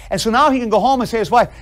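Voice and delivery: ordinary talk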